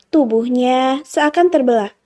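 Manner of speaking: plain reading voice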